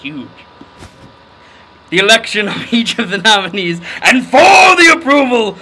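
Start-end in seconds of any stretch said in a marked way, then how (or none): 1.9-5.6 loud, shouted reading of the bold text; reading loudly. Shown as a demonstration. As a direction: belt it out like bold print. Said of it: "That's in quotes, like, really big".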